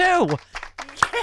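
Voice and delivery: high sarcastic yay; mock cheer. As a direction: cheer it with a smirk. Mock cheer at Cruz news.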